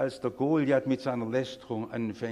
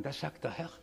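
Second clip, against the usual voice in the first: ordinary talk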